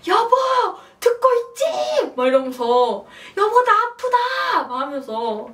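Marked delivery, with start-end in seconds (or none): 0.1-2.1 loud, very high yell, drawn out at the end; reenacted labor shout. Woman in labor calling her absent husband, reenacted shout. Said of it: "큰 소리로 막 진통할 때마다". 3.4-4.7 high, loud, drawn-out comic yell; reenacted pained shout. Woman in labor telling absent husband, reenacted shout. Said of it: "큰 소리로 막 진통할 때마다".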